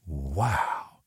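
soft awed whisper. A narrator's reading. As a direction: breathe it in awe. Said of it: "whispered Jamie".

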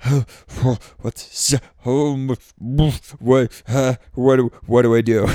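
imitating a panicked swimmer kicking and screaming; mock panicked screaming. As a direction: play it as a mock freakout, building as you go. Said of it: "screaming like".

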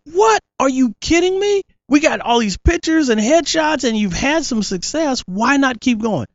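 high, incredulous mother's voice; shocked mom. Retold or imitated. Mother surprised her older kids want to quit, high and incredulous. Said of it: "my mom was like".

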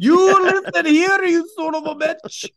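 very high, indignant fake Scottish; mock Scottish accent. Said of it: "That's not how we sound".

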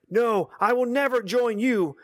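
a hint of a young hero's defiance, slowed down; defiant Luke. A narrator's reading. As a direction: Luke pulling back, refusing the dark side. Luke defies Vader.

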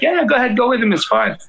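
breezy high go-ahead from the boss; casual boss. Retold or imitated. Boss shrugs it off in a high breezy voice. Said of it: "the guy who I worked for was like".

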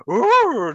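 a high, loud mock roar; Felger's roar. Host mimics the roar Felger makes about fans, loud mock roar. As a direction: belt out a sudden roar like the radio host. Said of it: "There's my Felger impression".

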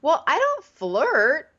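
slow, high smug denial; smug dude. Retold or imitated. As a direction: draw it out like a smug guy. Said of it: "And Dean's like".

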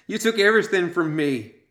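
high, loud anguished shriek. A narrator's reading. Maud shrieks her grief at the three women. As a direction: scream the blame at the hunters. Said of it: "she shrieked".

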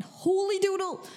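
a goofy burst of surprise; cartoonish mock astonishment; playful exclamation. Real, not acted.

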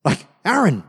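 Moses rounding on his brother, rising; exasperated demand. Acted. Moses confronting his brother Aaron.

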